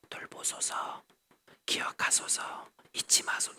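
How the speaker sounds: breathy whisper, lips barely moving; a weeping woman praying with no sound, whispered; silent whispered prayer. Shown as a demonstration. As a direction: mouth the prayer without voice. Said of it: "이렇게 기도를 한 거예요".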